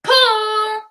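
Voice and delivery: sharp high call across the gym; a short distant shout. Acted. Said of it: "was heard shouting".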